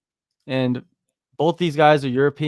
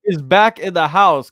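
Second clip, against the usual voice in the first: excited shout-out; 6 semitones higher, 6 dB louder than usual. Real, not acted. Hyped shout-out to a commenter.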